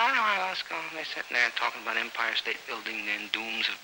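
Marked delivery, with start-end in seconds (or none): 0.0-0.8 put-on high, petulant kid's refusal; whiny child. A boy refusing his mother, whining. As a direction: sulk like a kid who won't go.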